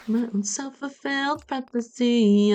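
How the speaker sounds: usual speaking voice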